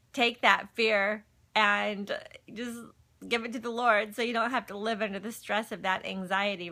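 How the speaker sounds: normal talking voice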